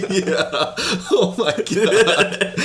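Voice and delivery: high, drawn-out words through laughter; helplessly cracking up; laughing hard. Real, not acted. Host loses it at co-host's point of attack joke, laughing.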